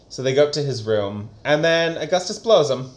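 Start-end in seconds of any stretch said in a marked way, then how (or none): none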